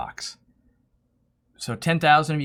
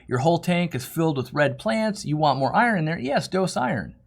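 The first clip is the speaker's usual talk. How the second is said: ordinary talk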